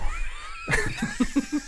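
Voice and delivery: high shrieking burst of laughter. Real, not acted. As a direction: let out a squealing laugh. Bursts out laughing after joke.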